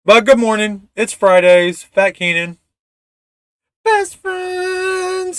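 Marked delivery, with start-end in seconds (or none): 3.8-5.4 very high falsetto catchphrase; high mock catchphrase voice; put-on catchphrase. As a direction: squeak it out playfully.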